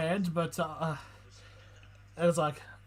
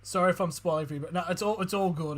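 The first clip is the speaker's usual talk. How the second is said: ordinary talk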